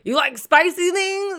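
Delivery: high, loud mock offer; loud, high questioning quote; imitated neighbour. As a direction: pitch it way up as the odd neighbour. Acts out neighbour offering food.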